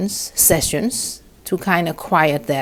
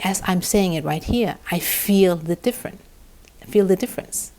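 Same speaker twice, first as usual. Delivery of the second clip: usual speaking voice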